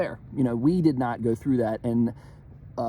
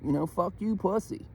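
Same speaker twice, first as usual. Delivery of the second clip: high, brash, dismissive retort; mock defiant retort; 8 semitones higher than usual. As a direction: toss it off cocky and high. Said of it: "I'll be like".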